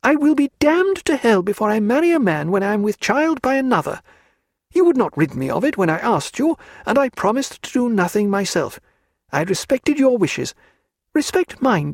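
a high, firm, defiant lady; stronger voice. A narrator's reading. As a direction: put steel into her refusal, pitched high. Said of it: "she said in a stronger voice".

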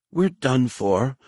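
muffled, whining droid voice, slow. A narrator's reading. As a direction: whine it as if from under a pile. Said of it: "a muffled synthetic voice whined".

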